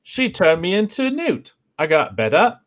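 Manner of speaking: a high, light try at a British accent; second go at the Monty Python accent. Shown as a demonstration. [name] retries the quote in a British accent. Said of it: "Let me give it another shot".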